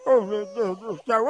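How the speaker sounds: usual speaking voice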